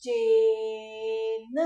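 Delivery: चेन read very slowly with the ए held; चेन, long held ए; slow clear reading. Shown as a demonstration. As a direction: sound out चेन slowly for the class. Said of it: "एक मात्रा आ गई".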